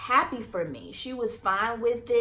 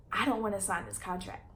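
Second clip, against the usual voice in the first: soft, voice close to tears; softer, holding back tears; emotional; 7 dB quieter than usual. Real, not acted. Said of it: "I'm getting a little emotional right now".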